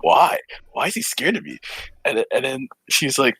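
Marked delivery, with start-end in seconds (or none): 0.0-1.6 higher, puzzled questioning; retold bafflement. Asking friend why Alex is scared, retold bafflement. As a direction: act out confusion, pitch climbing. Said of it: "big question marks in my head".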